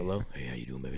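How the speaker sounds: too close to mic. Real, not acted. Mic in mouth, playing around.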